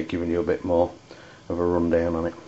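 usual speaking voice

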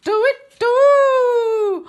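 very high, loud owl screech; angry owl hoot. Acted. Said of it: "And he says".

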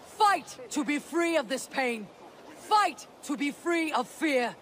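rousing battle speech. Acted. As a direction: address the fighters, driving each phrase home. Rallying speech to warriors before assault.

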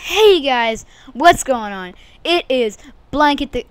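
loud, high hype greeting; shouted vlog greeting; hyped intro. Acted. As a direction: open the video with big energy. First attempt at intro.

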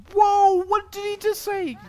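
high, loud, stunned whoa; startled gamer yelling at the screen; shocked outburst. Real, not acted. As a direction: jolt up in disbelief. Shocked by a line in the game.

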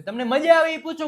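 high loud eager invitation; excited invite. Acted. Teacher eagerly inviting students, high and loud. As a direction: pitch it up with eager welcome.

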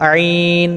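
slow আইন from the middle of the throat; mid-throat sound. Shown as a demonstration. Teacher telling learners to copy, throaty long আইন. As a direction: start আইন in the throat, then hold it. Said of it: "এভাবে".

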